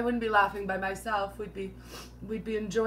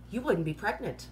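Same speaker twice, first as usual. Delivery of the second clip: usual speaking voice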